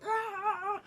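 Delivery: long high pained "ah"; high drawn-out mock wail; acted cry. Boy gets dirt flung at him, high cry.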